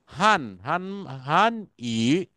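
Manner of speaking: হান ই drawn out slowly; slow word model. Shown as a demonstration. Teacher slowly repeats the word for students. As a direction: linger on হান ই for the class. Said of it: "teacher models the word".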